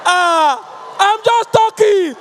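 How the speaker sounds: loud, high shout that he isn't shouting; shouting denial. Retold or imitated. Husband insisting to wife he isn't shouting, loud shout. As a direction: bellow that this is just talking. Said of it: "He said".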